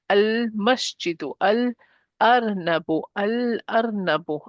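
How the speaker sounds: slow al-masjidu, al-arnabu with clear held L; lam pronounced. Shown as a demonstration. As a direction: linger on the L of each "al-". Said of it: "The lam is pronounced".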